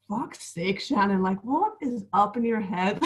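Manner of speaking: imitated Northern Irish accent; Keelan's northern accent. Mimicking her exasperated boyfriend for the host, Northern Irish accent. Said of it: "my boyfriend's from up north, so he'll be like".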